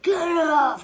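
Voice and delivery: sharp, loud shout; bus driver yelling. Retold or imitated. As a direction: bark it like an annoyed driver. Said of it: "the wheel, he went".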